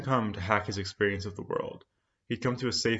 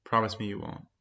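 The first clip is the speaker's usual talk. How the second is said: plain reading voice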